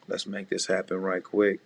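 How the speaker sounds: ordinary delivery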